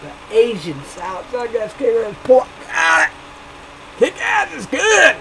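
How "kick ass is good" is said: much higher squeaky put-on voice; squeaky exclamation. Praising the spicy report, squeaky excited voice. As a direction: squeal it like a cartoon.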